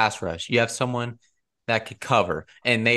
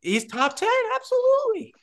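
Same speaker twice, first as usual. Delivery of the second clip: very high, emphatic squeal of certainty; defiant insistence. Real, not acted. Arguing with friends about Russell Wilson, insisting high and emphatic.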